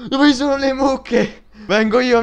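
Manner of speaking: high, loud and drawn out; excited charge. Real, not acted. Gamer charging at cows, high and loud. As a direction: call out as you charge in.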